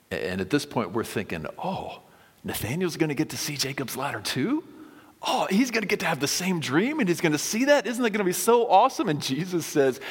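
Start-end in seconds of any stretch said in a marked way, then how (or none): 1.6-9.1 much higher, rushing faster, giddy; eager excitement. Retold or imitated. Listeners imagining Nathanael seeing Jacob's ladder, excited. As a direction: bubble over like a kid at Christmas.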